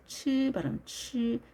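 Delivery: T and Y merged into "치"; T+Y as "chu". Shown as a demonstration. Teacher isolating merged sound, "치" as ch. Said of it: "T가 Y와 겹쳐서".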